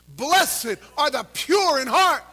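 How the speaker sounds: loud, high, slow recitation; recited with the crowd. Shown as a demonstration. Preacher leading congregation in reciting the verse, slow loud recitation. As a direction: lead the crowd through each word slowly. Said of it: "Ready to quote it with me?".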